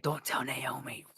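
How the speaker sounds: unvoiced hushed whisper. S1 voicing Aresha hushing after a stabbing, whispered. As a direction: whisper it like a secret. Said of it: "be like".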